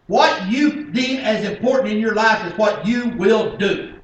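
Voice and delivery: loud, high-pitched pulpit delivery; full-force preaching. Acted. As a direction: hammer the point home to the congregation.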